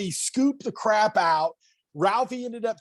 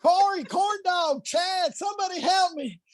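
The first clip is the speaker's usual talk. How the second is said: mock panicked shout; 12 semitones higher than usual. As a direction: yell for the guys, hammed-up panic. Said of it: "he's like".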